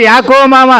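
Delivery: high, loud alarmed call; startled call. Acted. Son-in-law startled to see his father-in-law in the pit, shouting. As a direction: shout down in alarm at the fallen man.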